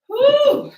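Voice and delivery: high, loud celebratory woo; excited whoop. Real, not acted. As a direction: let out a big cheer. Cheering after finishing a set.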